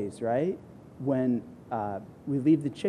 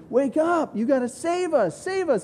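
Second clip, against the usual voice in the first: very high, desperate cry to wake him; screaming for help; 12 semitones higher than usual. Retold or imitated. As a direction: shriek for rescue in the storm. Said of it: "they're screaming".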